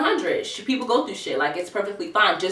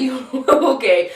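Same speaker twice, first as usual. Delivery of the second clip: laughing, slow and teasing; amused disbelief, drawn out; 5 semitones higher, 4 dB louder, much slower than usual. Retold or imitated. Mocking the nineteen-year-old.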